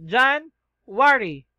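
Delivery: Jan-wari said slowly in two clear beats, JAN then WARI; sounded-out January. Shown as a demonstration. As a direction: drag out Jan-wari slowly, syllable by syllable, like a teacher showing the class. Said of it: "So, January".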